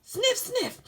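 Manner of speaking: sniffing. Acted. Character sniffing the air.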